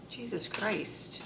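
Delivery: ordinary talk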